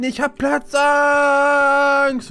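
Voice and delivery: slow, drawn-out panicky wail; panicked. Acted. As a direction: stretch it out in panic. Trapped in a tiny box.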